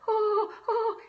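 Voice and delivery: high, loud mock wail of panic; freaking-out wail. Acting out someone freaking out, high mock wail. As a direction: exaggerate panicky "oh" cries. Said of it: "and you're freaking out".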